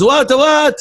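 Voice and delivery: high, baffled double question; bewildered tourist in a joke; French tourist. Acted. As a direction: pitch it way up, puzzled. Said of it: "and the French guy goes".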